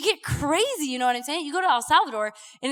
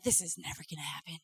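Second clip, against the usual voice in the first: discouraged mutter; 9 semitones lower, 13 dB quieter, faster than usual. Retold or imitated. Voicing a discouraged believer.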